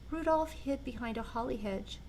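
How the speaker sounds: ordinary delivery, nothing marked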